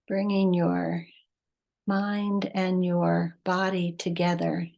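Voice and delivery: measured meditation voice with long pauses; guided meditation. Acted. Teacher leading listeners to breath and heart, guided meditation. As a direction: guide them unhurriedly, phrase by phrase, leaving space between.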